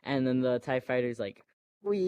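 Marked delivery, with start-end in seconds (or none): 1.8-2.1 high, long-held whee; imitated tie fighter. Tie fighter flying past Rey, imitated high whee. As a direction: squeal out one long flying noise.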